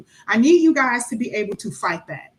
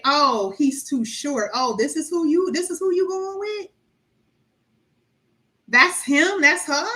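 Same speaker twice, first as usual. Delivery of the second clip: mock judgmental relative; 4 semitones higher than usual. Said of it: "your family members say".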